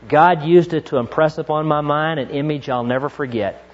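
ordinary talk, nothing marked

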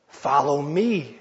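slow "Follow me" with the weight on "me"; slow, deliberate "Follow me" landing on "me"; stress on me. Shown as a demonstration. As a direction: drag it out and press "me". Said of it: "emphasize a different word".

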